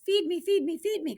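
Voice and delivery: high hungry whine; hungry baby duck. Acted. Hungry baby duck begging Jack, high whine. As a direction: beg for food in a tiny voice. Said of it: "cried the hungry baby duck".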